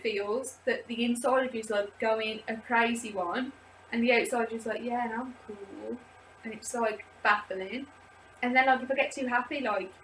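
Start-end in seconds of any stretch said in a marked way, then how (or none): 4.8-6.0 softer, breezy "I'm fine" act; mock nonchalant. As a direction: play it cool while shaking inside. Calm outside, shaking inside.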